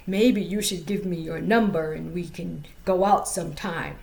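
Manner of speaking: put-on amateurish African dude accent; badly faked African accent; terrible African accent. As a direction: imitate an African man asking someone out. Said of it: "this is the terrible African accent".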